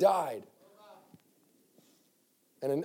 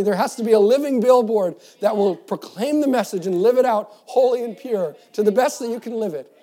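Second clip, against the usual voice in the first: higher, louder sermon climax; preacher lifting the congregation; full-force preaching; 5 semitones higher, 7 dB louder than usual. Acted. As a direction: proclaim it to the whole church. Altar call preaching.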